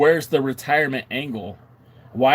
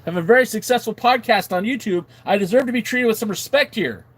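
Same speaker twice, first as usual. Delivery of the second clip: high-pitched, affronted plea; play-offended, pitched up and insistent; mock indignation; 8 semitones higher, 3 dB louder than usual. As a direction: protest as if your dignity is wounded. Mock indignant demand for respect.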